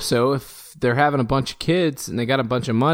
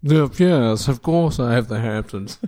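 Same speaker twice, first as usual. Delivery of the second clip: a lightly put-on posh Hamptons accent; half-hearted Hamptons drawl on request; slower than usual. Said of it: "do you have a Hamptons accent?".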